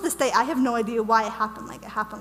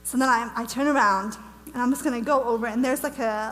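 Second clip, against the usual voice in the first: plain reading voice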